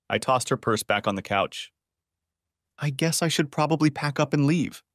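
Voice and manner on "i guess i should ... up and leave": usual speaking voice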